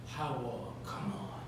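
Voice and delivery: hushed plea under his breath; whisper. Acted. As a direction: whisper it so nobody else hears. Sonny pleads quietly aside.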